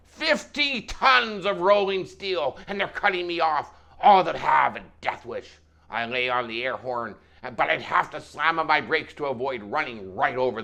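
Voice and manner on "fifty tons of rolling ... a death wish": loud, high outraged complaint; worked-up trucker's tirade; animated trucker rant. Acted. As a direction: hammer it out indignantly. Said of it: "even more animated and louder".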